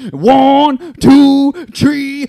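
high, loud drawn-out count; mock yelled counting. Nurse imitates ranting patient counting, mock yell. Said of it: "what he's yelling about".

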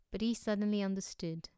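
ordinary delivery, nothing marked

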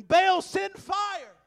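slow, drawn-out loud high cry; frantic shout. Acted. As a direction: bellow it like a desperate crowd. Prophets crying out to Baal.

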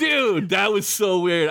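high-pitched, amused disbelief; amazed. Real, not acted. Host marvels at stray radio voice, real amazement. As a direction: react like something truly weird just happened.

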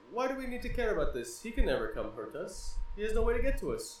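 dismissive Zeus. Retold or imitated. Zeus dismissing Thor's warning, scornful. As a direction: wave them away like a bored king. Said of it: "Zeus is like".

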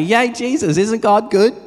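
high, loud joyful praise; excited cheer. Retold or imitated. Pastor's family cheering the neighbour's healing, high and loud. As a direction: celebrate out loud. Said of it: "that's our simple testimony".

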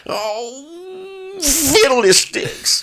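put-on very high, slow old prospector cry. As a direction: draw out a squeaky grumbling oath. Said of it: "that's old Prospector".

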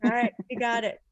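usual speaking voice

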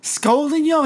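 high-pitched mock scolding; mock yelling. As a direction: imitate an indignant yell without much volume. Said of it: "He wants to yell at him".

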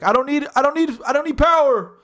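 high, strained shout to the back row; energetic projecting comic. Retold or imitated. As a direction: imitate a comic yelling without a mic. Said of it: "he had a lot of energy".

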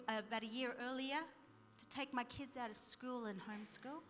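usual speaking voice

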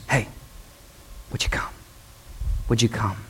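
hushed, near-whispered invitation; gentle call. Acted. God gently calling a reluctant sheep, hushed and tender. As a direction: coax softly and low, with pauses.

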